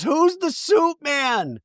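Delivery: drawn-out baffled high voice; imitating Heather. Heather asking Angie, mock puzzled. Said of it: "Heather goes".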